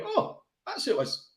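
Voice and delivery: high-pitched re-enacted "oh" of realisation; sudden realisation. Telling co-host about spotting the lookalike, high-pitched realisation. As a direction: act out the penny dropping. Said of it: "and I went".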